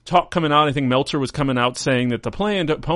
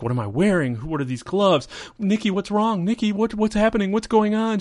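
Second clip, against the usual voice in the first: confused panic; 8 semitones higher than usual. Retold or imitated.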